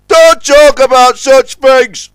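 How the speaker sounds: high, loud, slow warning; Scuttlebutt warning. A narrator's reading. Scuttlebutt forbids talk of ghost tales, warning. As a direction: warn urgently at full voice. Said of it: "warned Scuttlebutt Pete".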